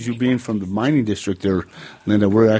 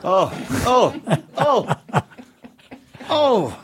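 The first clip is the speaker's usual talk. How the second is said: high squealing oh, oh, oh; shocked; 12 semitones higher, much slower than usual. Real, not acted. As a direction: recoil in mock horror, pitched up. Reacting to nude yoga image.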